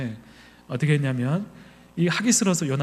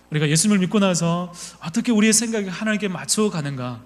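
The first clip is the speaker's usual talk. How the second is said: usual speaking voice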